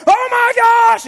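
high, loud hammed-up shriek; mock terrified scream. Frightened visitor taking off running, mock terrified scream. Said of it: "you start screaming".

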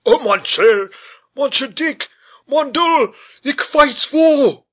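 put-on high, loud frantic French-accented wail; frantic high-pitched pleading, French accent; panicked French cry. A narrator's reading. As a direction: cry out in alarm as a French gentleman. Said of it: "and cried".

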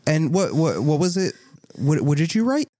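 high-pitched, hesitant librarian; startled librarian. Acted. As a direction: stammer a polite question in a high voice.

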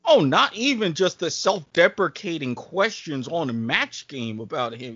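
ordinary talk

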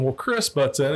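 ordinary talk

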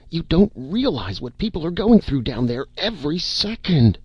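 panicked, begging them to act now; frantic pleading. A narrator's reading.

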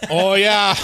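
loud, high mock Chicago accent; Obama with Chicago accent. Host imagines a president talking, Chicago accent. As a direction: belt out a hammed-up Chicago accent. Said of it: "like, Obama was like".

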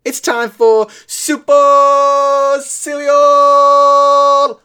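slow, booming high announcer call; booming fighting game announcer. Acted. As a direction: boom out the show title like an arena announcer. Segment intro called out.

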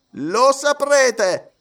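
high, very slow haughty judge; judge replying. A narrator's reading. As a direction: drag it out with lofty superiority. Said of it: "rispose il giudice". (giudice altezzoso, lentissimo)

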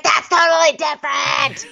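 hammed-up outraged reality star; indignant mock shout. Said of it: "And she's like".

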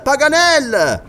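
slow loud hail to the treetop; drawn-out call. Acted. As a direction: stretch the name out as you shout it upward. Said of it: "gridò Glenarvan".

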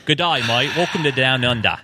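hammed-up Aussie greeting; playful, exaggerated Australian accent; mock Australian accent. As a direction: put on a broad Australian accent for a joke. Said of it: "better Australian accent than a British accent".

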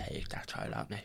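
a whispered aside. Retold or imitated. Aunt whispering her inner thought about her nephew. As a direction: hiss it under your breath. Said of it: "I literally was like".

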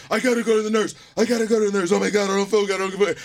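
high, urgent panicked pleading. Retold or imitated. Stoned student panicking, begging for the nurse. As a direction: beg to leave in a panic. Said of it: "And I'm like".

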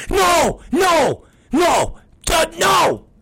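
high-pitched, repeated angry cries; retold angry refusal. Dad re-enacting his argument with wrestlers, angry yelling. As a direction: cut them off with strained, high refusals.

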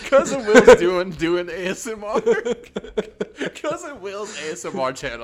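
high-pitched, laughing through the words; can barely get it out for laughing; cracking up. Real, not acted. Cracking up over cousin's imagined channel.

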